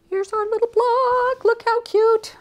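very high, drawn-out little coo; delighted high squeal. Real, not acted. Showing off the little block, very high cutesy voice.

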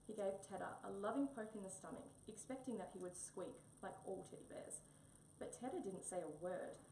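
ordinary delivery

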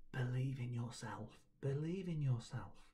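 soft, airy encouragement; faint inner voice. A narrator's reading. Faint voices remind Mouse to believe in herself, softly. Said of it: "she heard faint voices".

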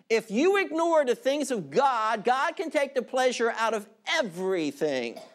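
normal talking voice

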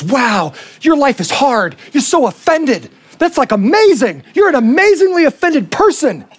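hammed-up awe that climbs to the end; mock admiration. Culture applauding an offended person, mock admiration. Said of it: "And our culture goes".

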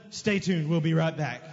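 a hint of a TV host; TV announcer. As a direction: mimic a television cutaway line. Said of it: "and then all of a sudden".

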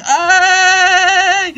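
long, loud, high held cheer; celebratory "hey". Real, not acted. Gamer cheering because we won it, long high cheer. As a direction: hold it like a sung victory cry.

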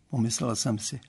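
ordinary delivery, nothing marked

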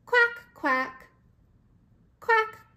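high, loud quacking; duck quack. Shown as a demonstration. Showing a toddler the duck, high loud quacks. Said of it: "Duck says".

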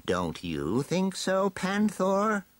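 slow, high gloating villain. Acted. Villain asks his evil cat to agree, slow rising gloat. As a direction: draw it out, rising high on the pet's name.

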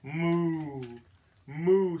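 long, drawn-out playful moo; cow moo. Pretend. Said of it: "says the cow".

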